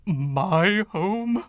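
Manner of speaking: aghast. Acted. Ghost aghast at corporation.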